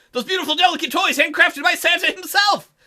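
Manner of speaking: ordinary delivery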